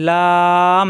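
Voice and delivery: লাম with the vowel held long; drawn-out letter name. Shown as a demonstration. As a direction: stretch the middle of লাম. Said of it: "এখানে কিন্তু টান দেওয়া যায়".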